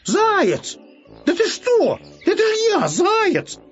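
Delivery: very high, exasperated squeal; shriek-pitched insistence; hare crying out. A narrator's reading. Hare shouts who he is to the bear, high-pitched cry. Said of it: "вдруг закричал".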